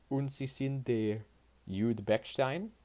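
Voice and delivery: lightly voiced interrogator, drawn out in German; Gestapo officer. Officer questions Pat about his passport, lightly voiced slow interrogation. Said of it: "he asked".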